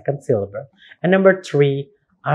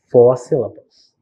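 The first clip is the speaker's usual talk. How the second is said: ordinary talk, nothing marked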